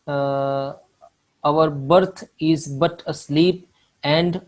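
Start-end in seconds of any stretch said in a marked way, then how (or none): none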